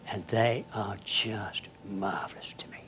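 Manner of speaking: softly whispered fondness; tender whisper. Real, not acted. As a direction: breathe it out with quiet affection. Tender memory of derelicts.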